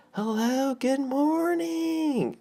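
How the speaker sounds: very high, drawn-out singsong hello; overfriendly Southern greeting. Acted. Fast food worker greeting customers, exaggerated Southern friendliness. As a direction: stretch it out high in exaggerated hospitality. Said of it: "That's how you talk as well".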